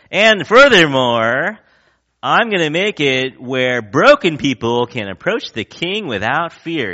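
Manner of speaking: lightly hammed-up campaign promise; mock candidate speech. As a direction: play the candidate at the podium. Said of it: "mock candidate speech".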